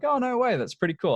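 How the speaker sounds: light up like it's great news; excited reaction. Retold or imitated. Retelling his chat with the mechanic, excitedly.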